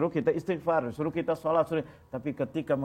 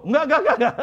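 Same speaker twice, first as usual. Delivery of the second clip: mock panicked refusal; 10 semitones higher, 7 dB louder than usual. Pilgrim refusing to see angel.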